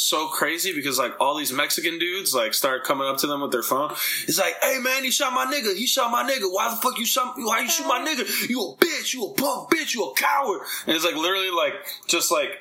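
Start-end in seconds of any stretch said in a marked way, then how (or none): 4.6-10.6 much higher, raging put-on street voice; angry bystanders. Crowd yelling at the cop who shot their friend, imitated fury. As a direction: hurl it at the cop like an outraged friend.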